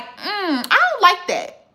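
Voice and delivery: high-pitched, put-out objection; retold disapproval. Creator recalling an unexpected restaurant bill, disapproving. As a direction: register distaste with a jump in pitch. Retells displeasure at being billed.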